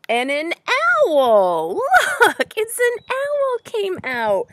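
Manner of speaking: loud, high-pitched excited exclamation; excited reveal. Real, not acted. Reader shows children the owl, loud and excited. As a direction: burst out with the big surprise.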